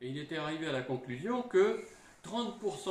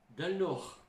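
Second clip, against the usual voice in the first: "del Nord" lightly said in a Northern ch'ti accent; a quick hint of ch'ti on "del Nord"; 4 semitones higher than usual. Shown as a demonstration. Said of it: "on parle comme les gens du Nord". (léger accent ch'ti sur « del Nord »)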